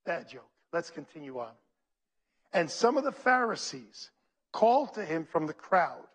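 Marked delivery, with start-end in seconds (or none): 0.0-1.5 muttered aside. Real, not acted. Self-deprecating aside after a pun.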